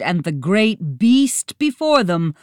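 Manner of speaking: usual speaking voice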